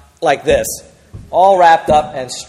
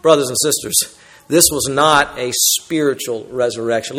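ordinary talk

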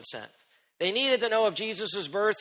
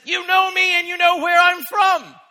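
slow, much higher, loud shout; proclaimed; 13 semitones higher, 9 dB louder than usual. Acted. Jesus answering the doubters, high slow loud shout. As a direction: ring it out over the crowd. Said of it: "But instead he says".

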